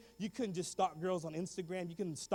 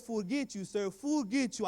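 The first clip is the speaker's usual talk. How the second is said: insistent put-on Spanish accent; faked Spanish-accented English; 2 semitones higher, slower than usual. Pupil explaining the swear to principal, faked Spanish accent. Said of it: "I forgot how to speak English properly".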